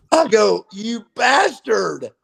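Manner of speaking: high, slow, laughing out an insult; fond laugh while calling him a bastard. Real, not acted. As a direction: call him a bastard through real laughter. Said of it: "I was laughing".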